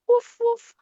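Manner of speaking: high, loud playful woof woof; dog barking. Acted. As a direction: bark like a cheerful puppy. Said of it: "Pop barks".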